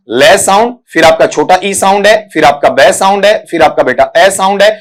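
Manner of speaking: ordinary talk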